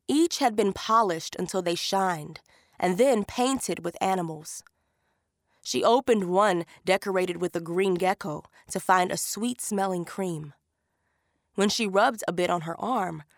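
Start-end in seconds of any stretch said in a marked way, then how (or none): none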